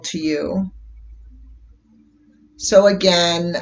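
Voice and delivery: gentle guided meditation voice. Acted. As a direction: ease listeners into calm with long pauses. Leads a guided color meditation.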